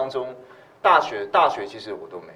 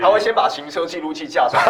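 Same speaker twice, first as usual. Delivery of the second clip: laughing; 11 semitones higher, 11 dB louder than usual. Real, not acted. Joking, laughing.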